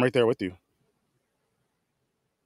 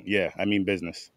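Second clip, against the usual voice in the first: usual speaking voice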